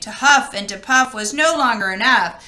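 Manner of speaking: usual speaking voice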